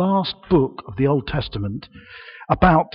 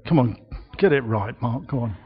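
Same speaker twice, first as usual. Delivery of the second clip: ordinary talk, nothing marked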